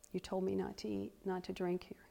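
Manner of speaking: soft, imagined plea to God; hushed questioning. Acted. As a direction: ask the Lord quietly, checking humbly. Voices humble question to the Lord.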